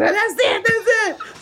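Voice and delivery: laughing, high and thrilled; giddy high exclaim; excited. Retold or imitated. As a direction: spot the bus and squeal with joy. Excited sighting of the band bus.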